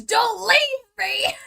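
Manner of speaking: high, loud, slow drawn-out wail; hammed-up tearful shriek; mock scream. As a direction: scream it like a kid being left behind. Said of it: "escaping other mother in the tunnel".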